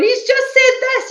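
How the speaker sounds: loud, high-pitched retold wailing; imitating a distraught phone call to her sister; mock tearful wail. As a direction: wail it out, hammed-up. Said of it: "I was in floods of tears to her".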